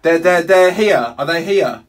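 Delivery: loud, high, stammered urgency; frantic. Real, not acted. Trapped caller asking if rescue is here, frantic. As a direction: call it out in real panic. Hopes rescuers have arrived.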